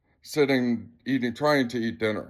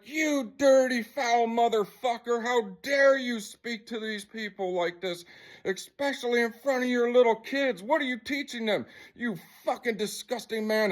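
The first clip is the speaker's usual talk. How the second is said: retold furious confrontation; 8 semitones higher than usual. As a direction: re-enact tearing into him, pitched up without shouting. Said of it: "coming at him like crazy".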